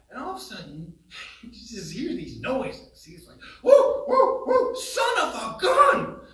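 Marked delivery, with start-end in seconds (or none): 3.6-6.2 much higher, loud, slow thrilled shout; excited yelling. Retold or imitated. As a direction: whoop like you just hooked a whale. Brother hooks a huge fish.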